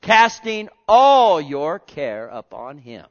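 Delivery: loud, slow, drawn-out proclaiming. Acted. Preacher driving the verse home to the congregation, slow and loud. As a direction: stretch every word and sound it out.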